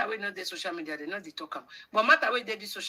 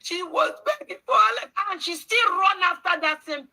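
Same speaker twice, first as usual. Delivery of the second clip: mocking shout; 12 semitones higher, 8 dB louder than usual. Mocking the sister's begging.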